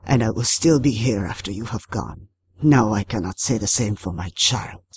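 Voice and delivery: low, hoarse water spirit; waterlogged creature voice, thickly accented; Tarabusao. A narrator's reading. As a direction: speak low as an ancient river creature. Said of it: "hoarse, waterlogged, speaking in an accent so thick".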